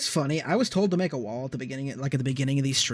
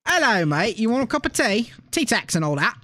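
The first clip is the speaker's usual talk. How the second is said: hammed-up British accent with a cheery rise; 6 semitones higher than usual. Friend playing a cheery Brit for his co-streamers, in a hammed-up British accent. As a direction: offer tea like a cartoon Englishman. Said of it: "The British are infecting me".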